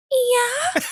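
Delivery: small high-pitched yeah; imitated "yeah". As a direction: mimic an overheard high, rising little yeah. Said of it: "like that".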